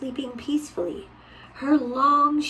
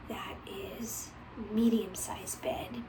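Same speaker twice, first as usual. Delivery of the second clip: soft whispered aside; hushed picture-book whisper; 10 dB quieter, slower than usual. Real, not acted.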